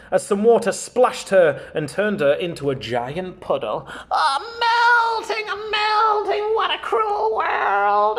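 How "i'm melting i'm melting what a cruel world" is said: high, slow wailing witch; melting witch. Acted. The Wicked Witch melting into a puddle, slow high wail. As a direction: wail slowly as you shrink away.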